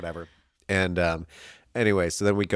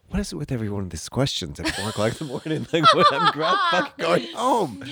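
fast, rising exasperation breaking into laughter; exasperated outburst; 9 semitones higher than usual. Acted. Friend retelling a late-night exit, exasperated. Acted outburst at being questioned.

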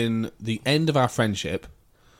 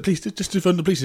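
pitched up, urgent pleading; pleading woman; 6 semitones higher, faster than usual. Retold or imitated. Woman at a kebab shop counter, urgent pleading. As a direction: beg them to ring the police. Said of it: "she was like".

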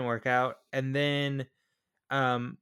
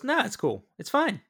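breezy, high-pitched retold brush-off; mock casual all-clear; 9 semitones higher than usual. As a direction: wave it off lightly, voice pitched up. Retelling a soldier's false all-clear.

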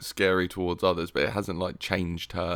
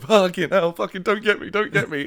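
high, loud, jumpy protest; panicked yelp, played for laughs; acted panic; 10 semitones higher, 5 dB louder than usual. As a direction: act out a flinching kitchen worker. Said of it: "I would constantly be like".

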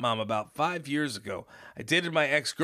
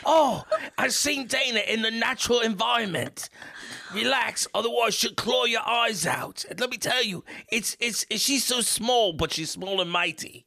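higher, lightly held mock Aussie voice; hammed-up fake Aussie accent, pitched higher; bad Australian accent; 7 semitones higher than usual. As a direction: keep up the botched Australian-British accent. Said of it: "it's not really Australian I'm doing".

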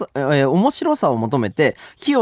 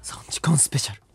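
whispering; 5 dB quieter than usual. Real, not acted. Echoing the announcement.

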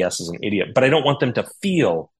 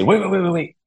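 excited interrupting; 10 semitones higher than usual. Retold or imitated. Stopping wife mid-sip, excited.

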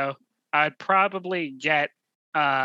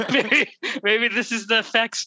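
laughing; 7 semitones higher than usual. Real, not acted. Joking about vaccine effects.

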